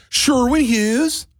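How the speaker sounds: high, drawn-out call of recognition; excited fan. Retold or imitated. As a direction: stretch the name out high.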